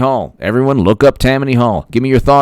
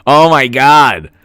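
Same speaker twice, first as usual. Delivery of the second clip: amazed; 4 semitones higher, 5 dB louder, slower than usual. Real, not acted. Reacting to a favourite movie.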